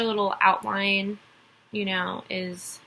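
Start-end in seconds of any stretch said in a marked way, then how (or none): none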